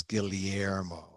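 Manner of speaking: Guillermo drawn out as "Gil-li-yer-mo", L clearly sounded; Filipino double-L Guillermo. Shown as a demonstration. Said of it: "the Filipinos say the double L's".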